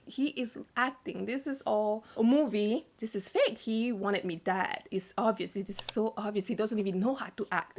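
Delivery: soft inner-voice accusation; hushed suspicion. Retold or imitated. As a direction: think it aloud under your voice, convinced. Retold private suspicion in her mind.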